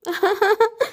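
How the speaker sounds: high, rising happy laugh; a bright burst of laughter; delighted laugh. Acted. Mother sees the magic crop, high delighted laugh.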